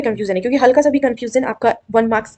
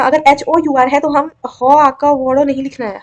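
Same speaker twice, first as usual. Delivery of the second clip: ordinary talk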